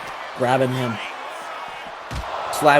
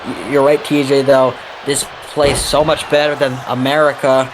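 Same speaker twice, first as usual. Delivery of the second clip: normal talking voice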